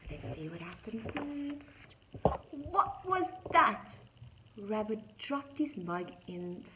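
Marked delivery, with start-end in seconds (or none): 2.7-3.8 loud, high startled question; alarmed cry. A narrator's reading. Story animals hear the knock again, alarmed cry. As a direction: jump at the noise, voice shooting up.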